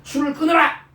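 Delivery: a hammed-up priest yelling at a woman he thinks is drunk; mock stern shout. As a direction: scold her like an indignant priest. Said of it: "그러면서".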